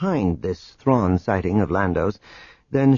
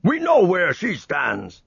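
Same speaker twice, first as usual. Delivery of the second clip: higher, slow angry call from the crowd; angry heckler; 8 semitones higher, slower than usual. A narrator's reading. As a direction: call it out with contempt. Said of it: "another angry voice called out".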